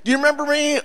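high-pitched hopeful question; acted eager greeting. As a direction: pipe up high like an old schoolmate.

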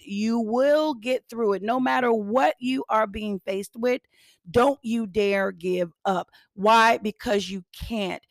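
ordinary talk, nothing marked

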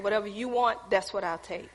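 bow your head and pray quietly; humble prayer. Retold or imitated. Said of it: "I just humbled myself".

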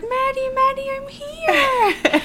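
high, laughing, soothing; high laughing mock comfort. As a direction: call her name through laughter. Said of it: "I was like".

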